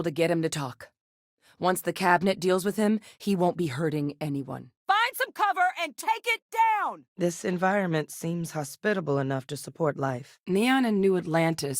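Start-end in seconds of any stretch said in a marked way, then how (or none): 4.9-7.0 high-pitched combat yell; shouted order. Acted. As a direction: call it out urgently across a firefight. Combat order called out in game.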